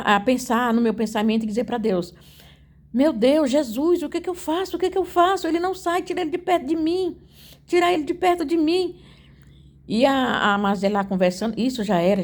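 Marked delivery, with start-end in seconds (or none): 2.9-8.9 high-pitched, reenacted begging; desperate plea. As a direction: plead urgently as if helpless and cornered. (súplica aflita, aguda, encenada)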